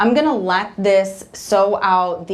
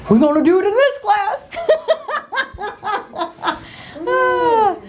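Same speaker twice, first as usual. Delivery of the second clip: laughing, voice jumping much higher; 13 semitones higher than usual. Real, not acted. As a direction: laugh it off at your own slip. Laughs at her own mistake.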